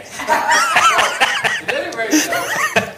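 high rising laugh. Real, not acted. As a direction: let out a big laugh. Laughing at own joke.

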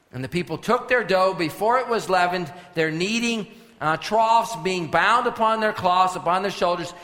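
preacher reading out loud and high; forceful scripture preaching. Acted. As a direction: proclaim the verses to the whole congregation. Reads scripture at full preaching force.

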